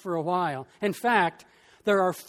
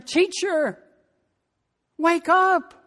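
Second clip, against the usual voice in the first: panicked disciples; 8 semitones higher, 4 dB louder, slower than usual. Acted. Disciples wake Jesus in storm.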